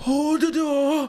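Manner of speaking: high, slow mock wail; acted desperate call; dramatic Hodor cry. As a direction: draw out each word in a high, hammed-up cry. Acting out Hodor's death scene.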